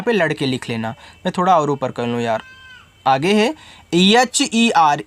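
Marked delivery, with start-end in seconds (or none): 3.9-5.0 एच ई आर spelled very slowly letter by letter; drawn-out letter spelling. Shown as a demonstration. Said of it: "इसको पढ़ते हैं हर".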